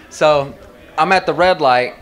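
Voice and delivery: ordinary delivery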